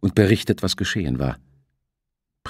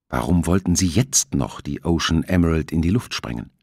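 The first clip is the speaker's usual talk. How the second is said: ordinary delivery, nothing marked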